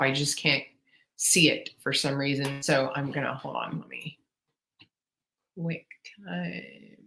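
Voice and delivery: low, very soft, distracted mumble; preoccupied thinking aloud; muttering to self. Real, not acted. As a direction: trail along under your breath while you troubleshoot. Still troubleshooting the screen share.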